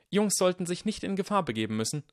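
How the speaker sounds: put-on high, bossy girl; a brave girl stepping in front; young girl voice. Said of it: "sagte sie".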